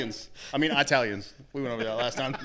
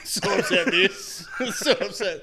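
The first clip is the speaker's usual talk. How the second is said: laughing; 5 semitones higher, 4 dB louder, much slower than usual. Real, not acted. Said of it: "You just got so upset".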